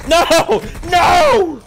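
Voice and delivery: two high, loud cries of no; anguished shout. Real, not acted. Player losing a fight in the game, anguished shout. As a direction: yell it out in defeat.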